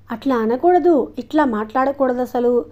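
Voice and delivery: plain reading voice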